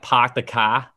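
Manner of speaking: Boston "Park the car" with dropped r, "cah"; Boston accent prompt, "Park the car" without the r. Shown as a demonstration. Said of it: "can you say".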